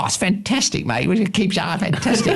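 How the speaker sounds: high, excited mock Cockney raving; John Daly impression. Producer raving about the footage to the director, high excited impression. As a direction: rave over it in a giddy high voice. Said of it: "I can't do his accent, but it was a Cockney accent".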